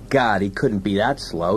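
ordinary delivery

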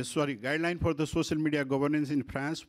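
ordinary talk, nothing marked

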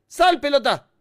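urgent shout. Real, not acted. Player ordering the ball out, urgent shout. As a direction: yell at it to get moving.

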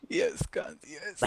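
breathy whispered triumph; whispered cheer. Real, not acted. Quiet victory whisper.

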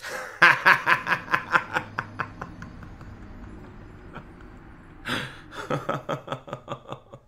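long, stuttering burst of laughter. Real, not acted. Streamer cracks up mid-game, long burst of laughter. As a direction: laugh hard, run out, then start up again.

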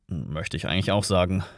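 low, soft aside; hushed agreement. A narrator's reading. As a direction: agree under cover, quietly. Hushed agreement among those hiding.

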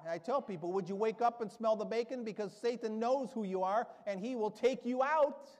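loud, rising sermon exhortation; fiery preaching. Acted. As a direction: preach it hard to the congregation. Preaching at full force.

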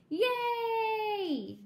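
cheer. Acted. Cheering at the plan.

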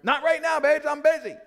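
high, loud brush-off; dismissive husband. Retold or imitated. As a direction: play the busy husband waving her away. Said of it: "sometimes I'm stupid".

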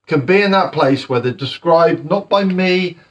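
ordinary talk, nothing marked

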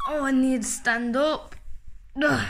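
ordinary talk, nothing marked

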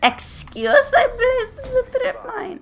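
very high, slowed squeaky voice. Real, not acted. Gamer making excuses in a silly high squeak. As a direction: squeak it out in a silly put-on pitch.

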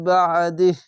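বাদিহ with a hard stop, no vowel; stopping on বাদিহ. Shown as a demonstration. Teacher shows how to stop at the pause mark. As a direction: cut বাদিহ short on silent h. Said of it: "এভাবে থেমে যাবেন".

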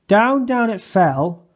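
usual speaking voice

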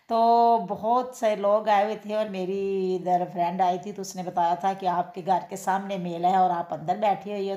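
usual speaking voice

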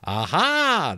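high, drawn-out knowing aha; chuckling sentry. Acted. Sentry pleased at being asked, high chuckling voice. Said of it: "the sentry chuckled".

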